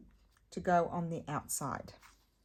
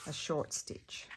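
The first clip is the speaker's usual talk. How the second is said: soft aside; 3 dB quieter, slower than usual. Shown as a demonstration. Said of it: "See, I mean short".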